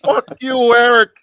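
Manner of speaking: hyping it up, laughing loud; laughing hype. Real, not acted. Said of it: "with the hands doing this".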